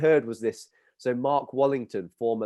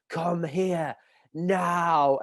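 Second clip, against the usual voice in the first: very slow, higher drawn-out command; stern teacher summons; 5 semitones higher, much slower than usual. Retold or imitated. PE teacher ordering the boy over, stern. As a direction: stretch each word out to carry.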